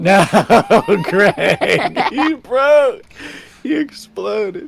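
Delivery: squealing high in disbelief; shocked laughing disbelief. Real, not acted. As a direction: cry it out, stunned and amused. Friend's character explodes in game.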